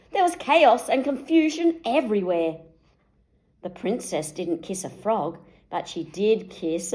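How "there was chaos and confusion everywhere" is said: usual speaking voice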